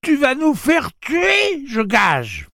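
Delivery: very high, loud, cracked-voiced scolding; slow, shrieked reproach in a broken voice; cracked, quavering cry. A narrator's reading. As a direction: wail the accusation in a thin, quavering voice. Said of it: "d'une voix fêlée, chevrotante".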